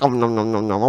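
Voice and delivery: soft, low munching nom noms; mock eating noises. To viewers while eating cake, soft munching sounds. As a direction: pretend to chew cake happily.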